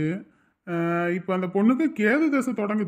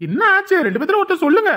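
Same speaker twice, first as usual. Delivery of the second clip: loud, high, urgent demand to know; husband demands what happened, raising his voice, loud and high; alarmed demand; 9 semitones higher, 5 dB louder than usual. Acted.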